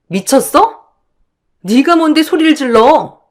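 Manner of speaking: high-pitched angry challenge; indignant retort. Acted. Acted quarrel, challenged back. (높은 톤으로 발끈하는 말투)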